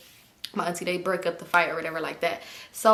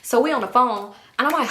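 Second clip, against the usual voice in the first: ordinary talk, nothing marked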